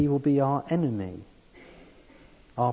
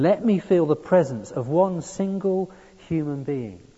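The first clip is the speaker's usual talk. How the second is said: loud, higher anguished howl; howling plea; 6 semitones higher, 6 dB louder than usual. A narrator's reading. Dying man howling for one human presence. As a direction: howl it out in despair. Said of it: "he howled again".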